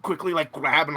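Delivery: ordinary delivery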